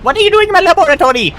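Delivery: put-on very high, fast, whiny boy genius; Dexter voice. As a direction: whine it fast like an outraged kid scientist. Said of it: "I kinda hit the D D".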